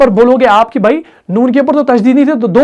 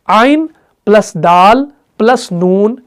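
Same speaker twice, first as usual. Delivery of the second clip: slow, letter-by-letter spelling; name each letter slowly, plus by plus; slower than usual. Shown as a demonstration. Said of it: "देखिए".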